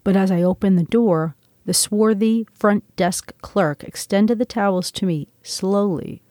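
ordinary delivery, nothing marked

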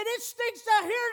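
put-on the old man's fed up now.

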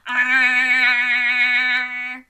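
long, loud, held ah; pretend toothy ah. Storyteller showing children all her teeth, held ah. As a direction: sound a big open grin, high.

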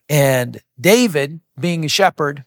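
ordinary delivery

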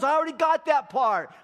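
high, loud comic protest; exasperated yell. Acted. Peter telling God he knows already, exasperated shouting. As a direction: cut in loudly, hammed-up impatience.

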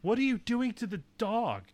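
high-pitched mock-alarmed mom. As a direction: call out to the kids, half-scolding. Acts mom catching the kids.

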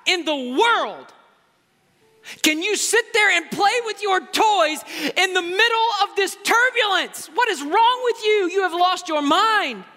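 high, loud, slow scolding voice of a frantic woman; panicked woman scolding. Retold or imitated. Panicking woman scolding the calm boy on the plane, high and shrill. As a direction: shriek it at the boy in disbelief. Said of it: "She says".

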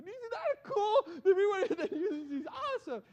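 proud and clueless, laugh through it; giddy excitement. Retold or imitated. To the pastor about the newspaper, giddy laughing brag. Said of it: "I said".

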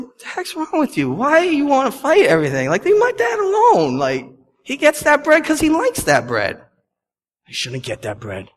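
a playful hint of an exasperated wife; Natalie scolding. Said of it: "Natalie said".

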